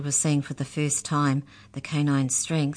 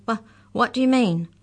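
higher, stammered question; stammering; 7 semitones higher, 4 dB louder than usual. A narrator's reading. Mallory asks the molars what they mean, stammering. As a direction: trip on the first word, pitch raised. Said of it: "stammered Mallory".

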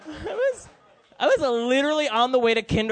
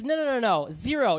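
ordinary talk

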